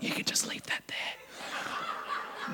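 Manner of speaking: slow, sly whispered aside; greedy whisper to the attendant; laughing whisper. Retold or imitated. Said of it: "And I'm like".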